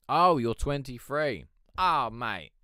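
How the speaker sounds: loud, drawn-out disbelief; shocked. Real, not acted. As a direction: hit him with real shock. Shocked realising chatter's age.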